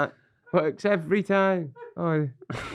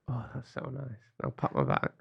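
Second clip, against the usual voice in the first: whisper; 2 semitones lower, 9 dB quieter than usual. Real, not acted. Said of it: "you'd burp me".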